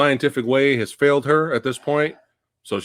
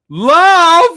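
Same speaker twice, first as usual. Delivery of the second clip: loud, high, drawn-out mock cry; mock exclamation; 11 dB louder than usual. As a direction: belt it out in exaggerated derision. Mocking the film's love explanation.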